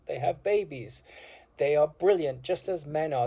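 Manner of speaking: normal talking voice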